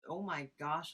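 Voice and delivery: ordinary delivery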